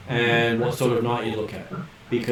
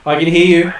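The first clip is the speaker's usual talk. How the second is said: speaking up; 4 semitones higher, 6 dB louder than usual. Real, not acted. Speaking up over bad reception.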